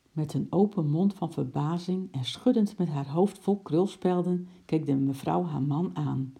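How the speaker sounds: plain reading voice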